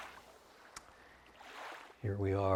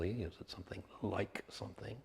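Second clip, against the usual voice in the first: ordinary talk, nothing marked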